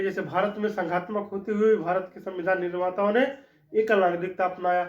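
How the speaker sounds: normal talking voice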